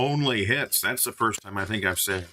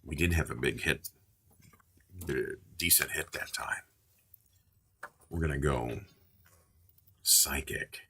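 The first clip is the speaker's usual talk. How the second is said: lower, softer put-on voice; 3 semitones lower, 6 dB quieter than usual. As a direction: keep the low hushed voice going. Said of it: "Maybe I gotta use that voice again".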